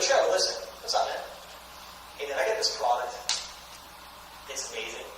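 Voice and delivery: low, soft, unhurried offer; calm and cool. Shown as a demonstration. Seller offering Trevor a free try, relaxed. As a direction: keep it casual and confident, no selling. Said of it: "Make sure you're calm, you're cool".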